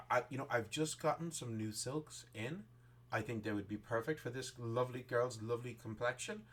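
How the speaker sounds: ordinary talk, nothing marked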